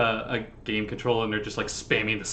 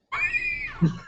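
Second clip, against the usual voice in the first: high wheezing cackle; wheezy old man laugh. Acted. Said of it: "That was his laugh".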